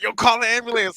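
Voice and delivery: demanding friend. Retold or imitated. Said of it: "he was like".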